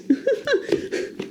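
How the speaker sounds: a quick airy laugh at his own joke; breathy laugh. Real, not acted. Vlogger laughing at his fake news stamp, airy laugh. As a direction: let out a short breathy laugh.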